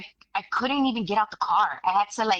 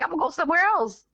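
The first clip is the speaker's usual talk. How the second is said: inner resolve; 9 semitones higher, faster than usual. Retold or imitated. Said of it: "In my head".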